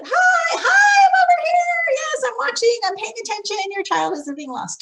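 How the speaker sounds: very high, loud call across the room; shout out. Shown as a demonstration. Teacher calling across the classroom to a parent, high and loud. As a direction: call out so the parent hears you. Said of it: "just kind of shout out a little bit".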